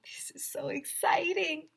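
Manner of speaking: breathless high whisper; Ryan's mom marveling at the pizza in a high whisper; excited whisper. A narrator's reading. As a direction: whisper the thrill so no one hears.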